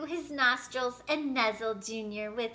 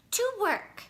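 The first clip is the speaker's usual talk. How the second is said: higher, eager burst; eager exclamation; 8 semitones higher than usual. A narrator's reading. A boy telling his mom again, eager. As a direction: insist brightly. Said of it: "Junior exclaimed again".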